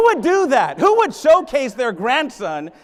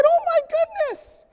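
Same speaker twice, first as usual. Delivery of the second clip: much higher, hammed-up astonishment; mock shock. Speaker mocking the news about poor kids, hammed-up shocked squeal. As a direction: play fake amazement, way up high. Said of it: "I was shocked".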